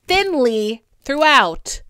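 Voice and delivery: usual speaking voice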